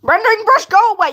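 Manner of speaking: high, loud, playful scolding; mock shout. Streamer scolds the rendering brush, mock shout.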